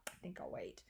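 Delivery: hushed, whispered aside to oneself; whispered thought. Retold or imitated. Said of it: "figured the square feet in my head and thought".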